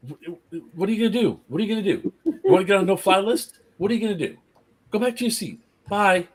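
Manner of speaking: high-pitched teasing singsong; mock taunt. Taunting the dad in retold quarrel.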